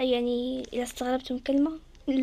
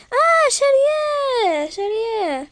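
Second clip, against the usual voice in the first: higher and slow, a long amazed cry; drawn-out gasp; 9 semitones higher, 6 dB louder, much slower than usual. Real, not acted. Player sees another wearing the hair, slow high amazement. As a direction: stretch the surprise out in wonder.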